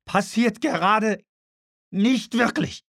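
hoch und langsam ausgerufen; verkünde es hoch, Wort für Wort; ungläubige Verkündung. Acted. Richard proclaims in disbelief. (hoher, gedehnter Ausruf)

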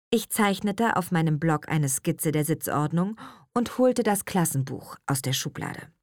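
ordinary delivery, nothing marked

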